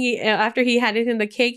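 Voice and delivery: normal talking voice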